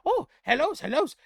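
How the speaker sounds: very high, loud little goblin; goblin greeting. Acted. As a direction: greet the room as an excited goblin. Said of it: "You see Gob scamper up".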